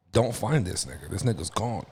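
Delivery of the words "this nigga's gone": usual speaking voice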